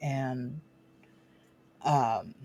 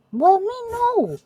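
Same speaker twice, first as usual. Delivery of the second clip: squeaky, much higher and louder squeal; 7 dB louder, slower than usual. Real, not acted. Owner mock-protesting to her dog in a squeal.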